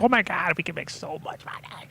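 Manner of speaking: high, hushed squeal of excitement; mock freak-out. Retelling the tulip craze, mock freak-out. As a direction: imitate people losing it, pitched high and thinning out. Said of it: "just freaking out".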